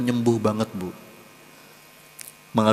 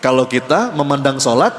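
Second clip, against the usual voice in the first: ordinary talk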